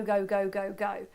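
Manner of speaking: normal talking voice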